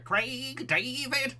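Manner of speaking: high, drawn-out parody cry. Acted. Streamer recalling a comedy show character, high drawn-out impression. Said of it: "I just hear [name] from Bo Selector".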